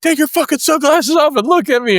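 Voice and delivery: barked order. Retold or imitated. Sergeant repeating his order to the recruit, sharper high shout. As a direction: bark it again even harder.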